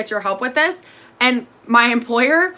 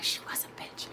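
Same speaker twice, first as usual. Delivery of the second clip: breathy whisper, no voice; whispered quote; 20 dB quieter than usual. Retold or imitated. Imagining [name] laughing, in a whisper. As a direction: whisper it as a snide aside. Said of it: "[name]'s gonna probably laugh at this and be like".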